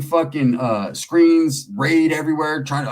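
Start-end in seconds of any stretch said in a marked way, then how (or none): none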